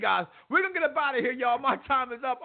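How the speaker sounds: ordinary talk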